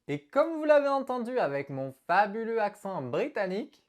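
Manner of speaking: ordinary delivery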